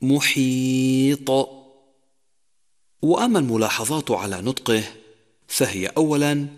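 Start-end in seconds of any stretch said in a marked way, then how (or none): 0.0-1.3 محيط with the final ط bounced in a clear echo; drawn-out محيط ending in a bounced ط; qalqala on ta. Shown as a demonstration. Tajwid teacher demonstrating qalqala. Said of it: "مثال ذلك".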